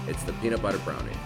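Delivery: usual speaking voice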